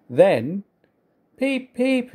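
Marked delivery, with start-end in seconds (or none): 1.4-2.1 two high, level whistle toots; train whistle peep. A narrator's reading. Edward struck by an idea, whistling peep peep. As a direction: pipe it high like an engine's whistle. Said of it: "he whistled".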